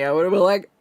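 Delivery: ordinary delivery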